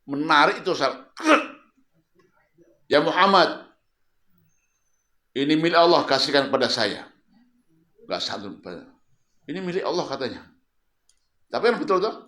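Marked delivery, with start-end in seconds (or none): 2.9-7.1 harsh, loud grabbing demand; loud and coarse; rude demand. Retold or imitated. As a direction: bark it like a rude stranger. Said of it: "Ada orang dengan kasar, bengis".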